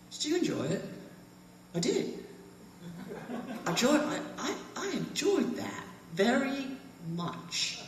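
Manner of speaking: imitating two old British men, polite British accent, laughter breaking through; a fond send-up of two old British tourists, cracking up; posh British accent. Quoting the British men at the back of the tour train, British accent with stifled laughter. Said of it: "They talk just like that".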